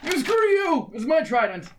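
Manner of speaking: put-on high, strained old man voice mid-tackle; exasperated shriek while tackling. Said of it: "That's not your voice".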